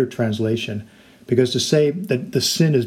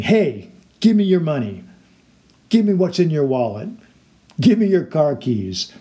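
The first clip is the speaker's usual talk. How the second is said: higher, lightly raised bully demands; mock bully yell; 4 semitones higher than usual. Wheelchair-bound bully demanding money from old victim, mild mock yell. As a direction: imitate a bully making demands, half yelled. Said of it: "he is yelling at me".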